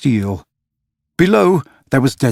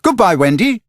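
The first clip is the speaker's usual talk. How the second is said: much higher, brisk forced cheer; forced cheery cry; 9 semitones higher than usual. A narrator's reading. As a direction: hide the hurt behind a bright send-off. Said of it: "cried Peter".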